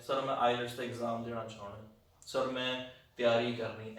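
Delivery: slow, subdued student addressing sir; slow-talking student. Shown as a demonstration. Teacher mimicking a student who talks to sir slowly, subdued. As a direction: take it slowly, with a pause, keeping it low-key. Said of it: "ਇਸ ਤਰੀਕੇ ਨਾਲ ਬੋਲਦੇ ਆ".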